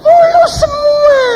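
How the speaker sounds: very high, drawn-out squeal of mock delight; teasing squeal. Mocking perfect dating looks.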